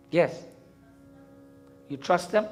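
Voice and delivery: ordinary talk, nothing marked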